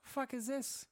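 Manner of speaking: high disbelieving exclamation; incredulous outburst. Retold or imitated. Watching a kid fire Roman candles, high disbelieving outburst. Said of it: "I was like".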